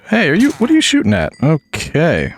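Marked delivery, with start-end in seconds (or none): 0.1-1.3 high, fast startled question. Real, not acted. Gamer startled by a skeleton shooting, high and fast. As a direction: jump in surprise.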